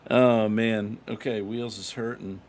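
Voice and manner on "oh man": frustrated groan. Real, not acted. Frustrated player, bad golf start.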